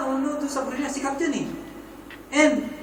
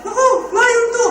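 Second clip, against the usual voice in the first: high, loud yelp of alarm; comic fright, pitched way up; retold panic; 12 semitones higher, 6 dB louder than usual. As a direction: cry out in mock panic. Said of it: "hindi niya nang gagawin niya".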